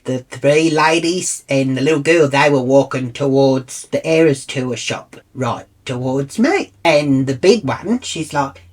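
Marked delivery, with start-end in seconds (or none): none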